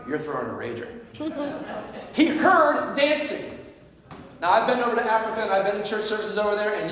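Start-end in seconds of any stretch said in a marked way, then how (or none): 0.9-2.1 laugh. Real, not acted. Laughs at own joke. 2.1-3.4 slow, rising "He heard dancing" with "heard" stretched high and loud; stress on heard. Shown as a demonstration. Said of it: "but if you hear dancing".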